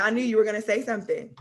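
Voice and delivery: ordinary talk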